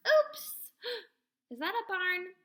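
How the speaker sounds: high, loud oops; a sudden startled oops; surprised oops. A narrator's reading. Animals in wrong place.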